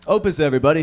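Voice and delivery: ordinary delivery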